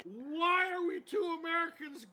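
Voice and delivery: high-pitched incredulous yelling; angry guy. Acted. Angry guy questioning the story's logic, exasperated shouting. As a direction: demand an explanation, exasperated. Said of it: "you're the angry guy who didn't make the".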